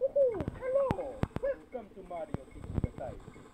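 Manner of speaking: soft Italian plumber greeting, high at first; quiet cartoon mascot welcome; Mario. Shown as a demonstration. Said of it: "This is Mario".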